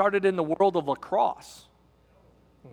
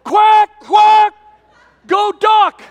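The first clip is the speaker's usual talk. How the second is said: cheering shout; 12 semitones higher, 10 dB louder, much slower than usual. Retold or imitated. Dad cheering his son's goal from the sidelines, full-voiced shout. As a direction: yell "Yeah, Mason" and "Quack, quack" high and loud from the stands. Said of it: "I said".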